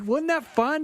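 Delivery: high, chirpy mock question; happily clueless; lightly voiced cheery Andy; oblivious bandmate. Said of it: "Andy's like".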